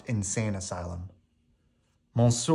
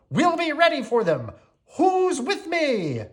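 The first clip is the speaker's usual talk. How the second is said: rallying Cogsworth; 3 dB louder than usual. A narrator's reading. Cogsworth rallying servants against the mob. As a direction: puff up and rally the troops. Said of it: "cried Cogsworth".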